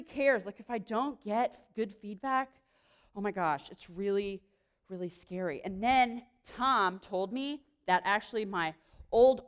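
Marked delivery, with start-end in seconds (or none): none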